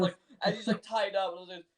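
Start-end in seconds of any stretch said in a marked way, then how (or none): none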